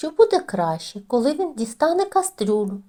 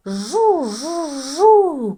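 car noise; 5 semitones higher, 6 dB louder, much slower than usual. A narrator's reading. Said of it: "кричить Тім".

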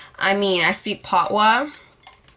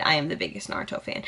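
whispered boast; 4 semitones lower, 7 dB quieter, much faster than usual. Real, not acted. Fan boast.

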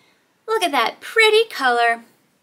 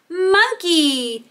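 ordinary talk, nothing marked

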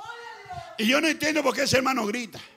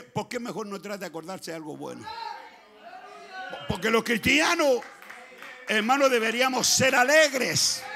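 usual speaking voice

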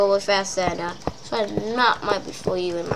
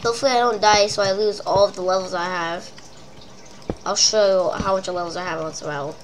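ordinary talk

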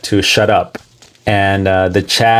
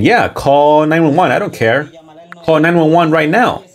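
higher, daring dare to call the police; taunting; 6 semitones higher than usual. Retold or imitated. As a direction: goad them: go on, call. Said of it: "he's taunting them".

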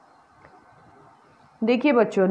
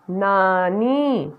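very slow, stretched word; slow blending; much slower than usual. Shown as a demonstration. Teacher blends मटकी slowly for children, stretched. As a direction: draw each sound out for the class.

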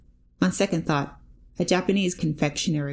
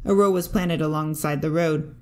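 ordinary delivery, nothing marked

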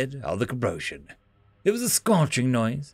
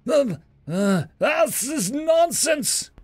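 gabbling; 12 semitones higher, slower than usual. Acted. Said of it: "gabbled the Cabrosian".

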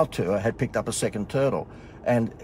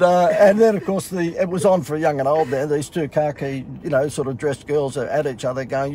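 usual speaking voice

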